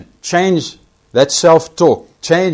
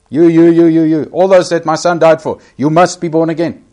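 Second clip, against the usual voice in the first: insistent calling out; 4 semitones higher, 6 dB louder than usual. Acted. God calling everyone, preacher voicing.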